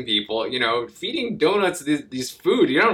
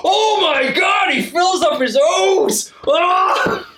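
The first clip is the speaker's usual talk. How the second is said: very high, drawn-out shrieking hilarity; mock hysterical crowd; 4 dB louder, much slower than usual. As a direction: shriek it like a crowd cracking up, hammed-up. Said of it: "They're cracking up at this".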